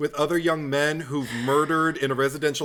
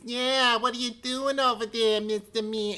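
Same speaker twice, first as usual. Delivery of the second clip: higher cartoon New York tough voice; mock character voice; 8 semitones higher than usual. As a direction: toss it off like a B-movie goon. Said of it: "they all got character voices".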